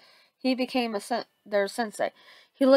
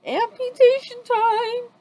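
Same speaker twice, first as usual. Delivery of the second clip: much higher and louder, slow and gleeful, in a changed voice; gleeful threat; 13 semitones higher, 5 dB louder, slower than usual. Acted. Naruto threatens to cut Sasuke's hand, gleeful. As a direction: relish the threat like a gleeful maniac.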